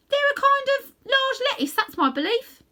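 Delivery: high, loud little rabbit. A narrator's reading. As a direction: chirp it like a know-all rabbit. Rabbit speaks.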